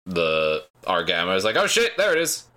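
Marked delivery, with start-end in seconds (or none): 1.6-2.4 high, surprised yelp of a line; mock-startled "oh shit"; startled crew. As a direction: blurt it out like the crew just spotted it. Ship crew spotting the shuttle.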